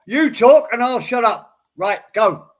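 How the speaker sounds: loud interruption. Real, not acted. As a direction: talk over him to hand him the floor. Cutting in to let him speak.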